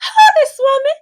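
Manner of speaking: mocking a jealous wife confronting a rival; imitating jealous Haley. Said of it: "She's like".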